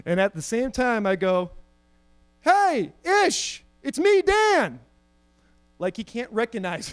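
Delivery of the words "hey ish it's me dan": high-pitched eager call; calling out to friend. Retold or imitated. Kid greeting his friend across the room, calling out high. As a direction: pipe up high to get his attention.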